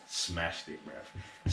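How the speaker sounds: a low, breathy aside, barely voiced; low murmur. Real, not acted. Praising Wesley to the viewer in a low murmur. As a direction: drop low and murmur it, nearly whispered.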